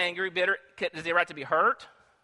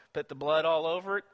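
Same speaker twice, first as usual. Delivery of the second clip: normal talking voice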